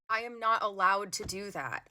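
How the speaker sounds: ordinary talk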